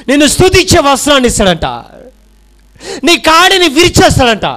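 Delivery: shouted, high-pitched sermon lines; full-force preaching. Acted. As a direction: preach it out with fire to the crowd. Preaching climax. (పూర్తి ఉత్సాహంతో బోధన)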